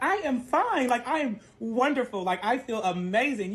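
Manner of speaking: high, slow, overbright insisting; fake-cheerful. To worried friends, a high mock-cheerful brush-off. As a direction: sing out how fine you are. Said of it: "I'm like".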